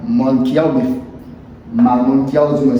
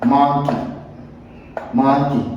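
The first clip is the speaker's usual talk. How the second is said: usual speaking voice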